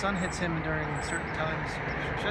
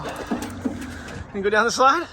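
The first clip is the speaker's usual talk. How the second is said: coaxing the dog; 5 semitones higher, 4 dB louder, slower than usual. Real, not acted.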